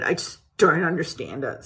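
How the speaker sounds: ordinary delivery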